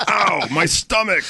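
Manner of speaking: a cheap voice box complaining; lightly voiced doll in pain; talking doll voice. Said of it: "six worst lines ever".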